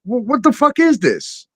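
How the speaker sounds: stammering, outraged disbelief; retold incredulous outburst. Telling off TikTok to a friend, rising in outrage. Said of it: "I said".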